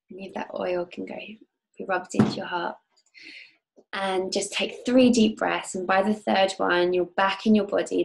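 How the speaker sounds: calm guide leading a visualization; guided meditation. Acted. Leads a guided oil meditation.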